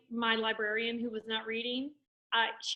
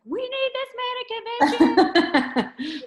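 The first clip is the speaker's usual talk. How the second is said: thrilled; 13 semitones higher, 11 dB louder than usual. Retold or imitated. Texting Alec that she must book this man, thrilled high shout. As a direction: gush like you found the perfect speaker.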